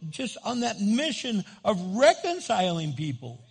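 loud, high-pitched sermon climax; impassioned pulpit delivery, key words stretched; fervent preaching. Real, not acted. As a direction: preach it with rising force.

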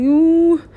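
high, loud "ooh" wail; crying wail. Retold or imitated. Speaker answering "why are you crying", high wail. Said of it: "Why are you crying?".

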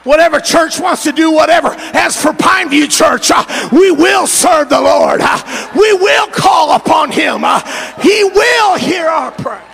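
rally the church, shout it out; full-force preaching. Acted. Pastor preaching to the Mother's Day congregation, full-force shouted preaching.

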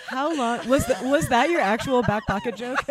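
laughing through the words. Real, not acted. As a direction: tease while laughing hard. Laughing at pun.

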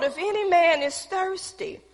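high, ringing preacher's proclamation; said loud. Acted. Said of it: "stood up and said it loud".

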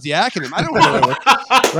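talking through a laugh; a chuckle while telling the story. Real, not acted. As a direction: laugh at your own cluelessness. Laughs at his own confusion.

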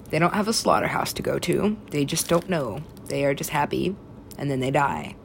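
usual speaking voice

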